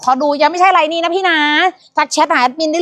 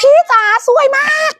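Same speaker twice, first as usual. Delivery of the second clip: excited shout; 13 semitones higher than usual. Real, not acted. Host shouts praise to the customer, much higher.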